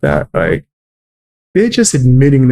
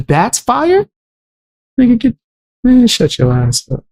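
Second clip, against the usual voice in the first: scoffing disbelief; 9 semitones higher than usual. Real, not acted. Mocking commenters calling it fire.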